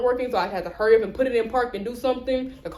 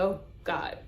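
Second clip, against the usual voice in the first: quiet dread; 4 semitones lower, 11 dB quieter than usual. Retold or imitated. Dread over the car news.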